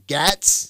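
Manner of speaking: GATZ with a short a, as in cats; tentative pronunciation. Real, not acted. Host checking a congressman's name with caller, unsure "Gatz" with short a. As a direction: try "Gatz" with a short flat vowel, questioning. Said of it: "Is that how you say it".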